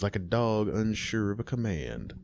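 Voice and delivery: ordinary delivery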